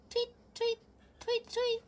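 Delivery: high loud tweeting; bird tweet. Acted. Starling sound second time.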